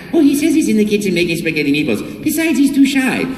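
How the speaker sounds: high, bright Mario relaying. Retold or imitated. Mario relaying Luigi's excuse to the child, high. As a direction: pass on the excuse cheerfully. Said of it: "And then I'd go".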